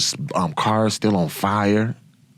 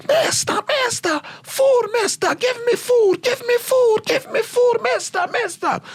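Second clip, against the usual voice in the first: put-on much higher, pleading child voices; begging Iraqi kids. Iraqi kids begging the soldiers for food, high pleading. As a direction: play hungry kids crying out for food.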